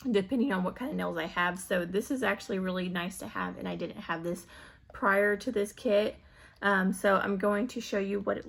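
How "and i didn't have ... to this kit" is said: ordinary talk, nothing marked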